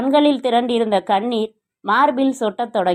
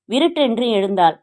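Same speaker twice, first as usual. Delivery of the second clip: usual speaking voice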